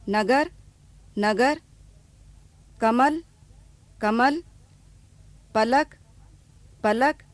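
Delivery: नगर, कमल, पलक said with no vowel at the end; final a dropped. Shown as a demonstration. Teacher showing the silent final अ. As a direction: clip the last अ off each word. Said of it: "शब्द के अंत में अ ध्वनि का उच्चारण नहीं होता".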